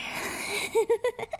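a high playful laugh. Acted. Girlfriend laughing as she pulls him close, high laugh.

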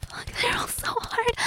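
high, soft, breathy whisper; hushed whisper to her lover. Acted. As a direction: breathe it out, barely voiced. Aroused whisper while being watched.

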